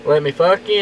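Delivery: usual speaking voice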